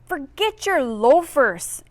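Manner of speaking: slow, pointed bully snap; snapping. Acted. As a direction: cut him off coldly. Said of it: "Angel snapped".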